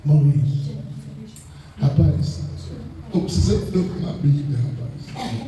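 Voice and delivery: low, humble begging for a Bible; pleading request. Retold or imitated. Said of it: "E outra coisa eu chegava".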